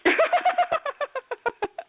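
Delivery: high, long real laugh; burst of laughter. Real, not acted. Host breaks up laughing at guest's answer. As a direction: crack up helplessly.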